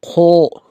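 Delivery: खो said thick from the throat; heavy letter. Shown as a demonstration. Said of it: "इसको मोटा पढ़ना है".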